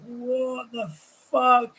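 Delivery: mock gobsmacked reaction, very slow and high; stunned disbelief. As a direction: stretch it out slowly, baffled. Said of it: "And we were like".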